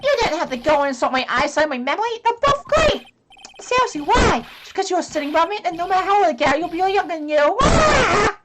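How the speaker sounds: high, loud indignant complaint; upset witness. Acted. Old witness complaining to the prosecutor, indignant. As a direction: protest hurt and indignant.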